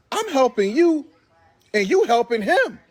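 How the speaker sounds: high, disbelieving protest; amazed complaint. Retold or imitated. Man turning on the woman who hit him, high amazed protest.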